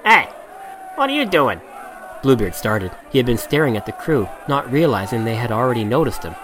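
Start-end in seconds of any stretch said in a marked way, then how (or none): 0.1-1.6 higher, sharp pirate call; pirate challenge. A narrator's reading. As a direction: catch him out, rough.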